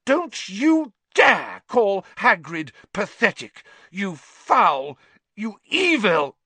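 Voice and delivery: high, loud, slow and seething; furious. Acted. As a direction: spit each insult at him. Hermione furious.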